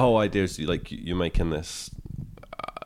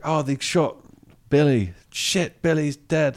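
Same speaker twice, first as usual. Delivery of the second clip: game NPC reaction; 10 semitones higher, 4 dB louder, slower than usual. Retold or imitated. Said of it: "what's supposed to happen is like".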